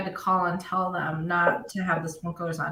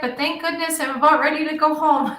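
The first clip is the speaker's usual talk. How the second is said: higher and louder, mock cheerful; mock cheery quote; 6 semitones higher, 3 dB louder than usual. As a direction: sing it out like a cheery complaint. Mock quote of own sick self.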